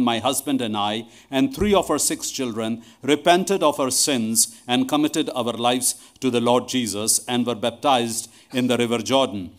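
normal talking voice

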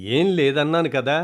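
mildly annoyed brush-off; irritated. Acted. Daughter brushing off her mother, mildly irritated. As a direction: wave the question away with a small huff. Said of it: "విసుక్కుంటూ".

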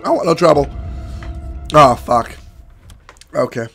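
ordinary talk, nothing marked

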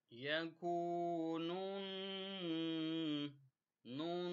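nasal ghunna demo. Shown as a demonstration. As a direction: hold the نون through the nose. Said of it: "آپ غنہ بھی کریں گے".

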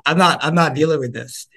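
ordinary talk, nothing marked

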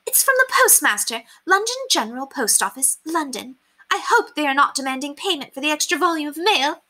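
high, fussy aristocratic lady; high put-on voice of a pampered lady; haughty lady voice. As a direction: play her as a vain society lady. Lady Constance voiced in story.

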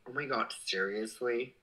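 normal talking voice

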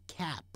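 whispered cap, no sound on p; unreleased final p. Shown as a demonstration. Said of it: "但是没有声音的cap".

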